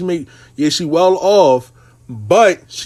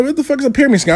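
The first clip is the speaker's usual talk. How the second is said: high, incredulous outburst; retold disbelief; 9 semitones higher, much faster than usual. Telling a client her plan sounds insane, incredulous. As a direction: voice jumps up in shock at a crazy idea. Hearing the client's pyramid scheme idea.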